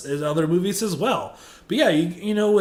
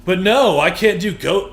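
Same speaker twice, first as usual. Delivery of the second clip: normal talking voice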